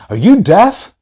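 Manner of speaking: high-pitched mock challenge; angry confrontation. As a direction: imitate an irate man demanding an answer. Angry man's challenge retold again.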